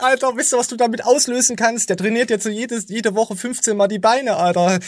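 high, worked-up disbelief; incredulous. Real, not acted. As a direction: rant in shocked outrage. Outraged rant continuing.

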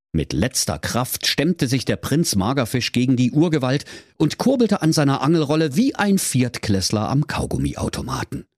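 usual speaking voice

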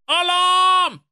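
shrill high king's alarm cry; alarmed shout. Acted. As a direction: yell it high and loud. (schriller Alarmschrei)

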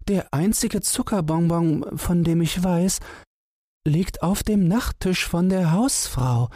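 high complaining mouse voice; a tiny mouse lamenting her trouble; high mouse voice, mildly plaintive; plaintive little mouse. A narrator's reading. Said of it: "rief Wackelohr klagend".

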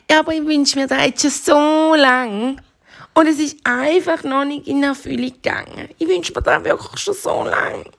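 high, pleading bunny voice; little hare wishing for too long; sad little bunny. Acted. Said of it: "wird das Häsli ganz traurig".

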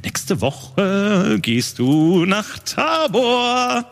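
dramatic reveal. Acted. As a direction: drop the news like a bombshell. GM reveals shocking news dramatically.

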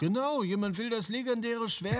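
far from the microphone; speaking away from the mic. Real, not acted. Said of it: "Schaletti ins Mikro".

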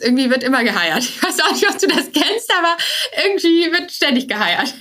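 high, laughing mock exasperation; amused. Real, not acted. Guest joking to host about endless hiring, high and amused.

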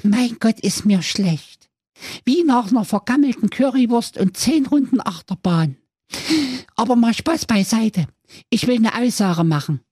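sehr hohe, piepsige Zicklein-Stimme, leicht japsend; hoch und quäkig wie ein junges Zicklein; kleinstes Geißlein. Acted. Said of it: "das kleinste Geißlein japste". (hohes Geißlein-Gepiepse nach der Drehtür)

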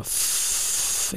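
long unvoiced puff of air; puffed sigh. Shown as a demonstration. Acting out a wordless reply to "how are you", puffed out. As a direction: blow out a long, soft "pff".

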